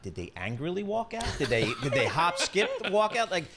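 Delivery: host joking about the walkout, laughing as he asks; teasing laugh. Real, not acted. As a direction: tease the reporter through a chuckle.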